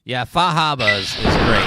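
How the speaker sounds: loud hammed-up Boston accent. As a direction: drop the r's in Fahaba, Boston style. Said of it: "thick Boston accent".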